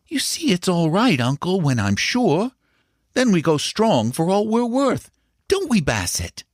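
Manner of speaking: put-on high, eager young boy's voice; a boy boasting to his uncle; boy Paul. A narrator's reading. Young Paul assures his uncle and Bassett, eager and high.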